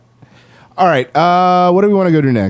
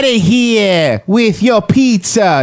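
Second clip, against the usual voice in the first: mock Brooklyn accent; 7 semitones higher than usual. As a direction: ham up a Brooklyn street guy brushing someone off. Mock Brooklyn heckler in pizza talk.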